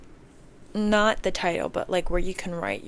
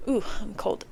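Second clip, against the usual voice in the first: ordinary talk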